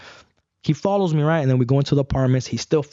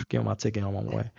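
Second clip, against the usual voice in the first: sighing, weary; 4 semitones lower, 9 dB quieter than usual. Retold or imitated. Weary repeat after a sigh.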